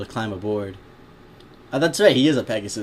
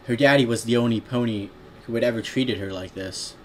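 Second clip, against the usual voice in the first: usual speaking voice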